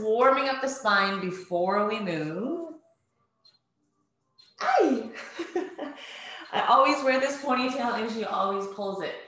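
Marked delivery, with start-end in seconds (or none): 4.6-6.5 startled yelp into a giggle; surprised laugh. Real, not acted. Baby pulls her ponytail.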